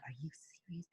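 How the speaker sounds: breathless whisper of disbelief. Retold or imitated. Whispering to herself on learning her co-host. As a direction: hush it like you can't believe it.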